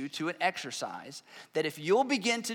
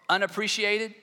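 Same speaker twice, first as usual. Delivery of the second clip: ordinary talk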